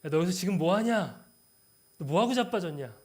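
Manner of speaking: loud, rough mock scolding; scolding rebuke. Shown as a demonstration. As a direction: tell him off like a harsh boss. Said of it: "이렇게 읽으신다면".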